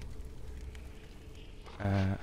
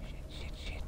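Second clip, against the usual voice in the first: hushed, voiceless swearing under the breath; nervous whisper; 12 dB quieter than usual. Real, not acted. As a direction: whisper it as if afraid to be found. Creeping nervously through horror game.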